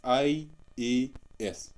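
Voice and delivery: "आईएएस" as three slow, separate letters; letters read separately. Shown as a demonstration. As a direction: pause between I, A and S in "आईएएस". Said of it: "अलग-अलग पढ़ेंगे". ("आईएएस" धीरे, अक्षर अलग-अलग)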